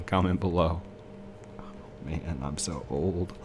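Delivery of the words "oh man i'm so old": slow, softer joking groan; mock lament. Player joking to viewers about his age, mock lament. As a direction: drag it out in pretend dismay. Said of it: "I'm just kidding".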